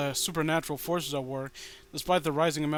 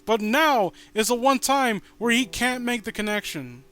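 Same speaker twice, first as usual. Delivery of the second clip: loud, raised, frustrated ranting; fed-up rant, louder and higher; exasperated rant; 7 semitones higher, 8 dB louder than usual. Real, not acted. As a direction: vent it loudly at the character's stupidity. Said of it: "I know I just went on a rant".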